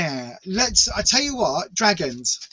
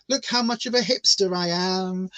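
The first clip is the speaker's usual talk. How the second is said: ordinary talk, nothing marked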